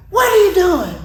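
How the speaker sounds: loud, high-pitched demand; yelling officer. Retold or imitated. Officer at the car window, yelling at the driver. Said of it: "why he was yelling".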